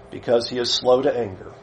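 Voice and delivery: soft, low and reverent; a devout whisper-soft line; hushed reverent. Acted. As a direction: murmur it like a prayer. Quiet reading of the passage.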